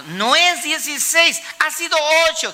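high, insistent acted protest; insistent correction. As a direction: pitch it well up, correcting someone deceived. Acting out correcting the deceived. (corrección insistente en tono agudo)

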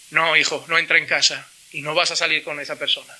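low, firm fatherly refusal; a father firmly refusing his son's girlfriend; stern father. Retold or imitated. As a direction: lay down the law to your son.

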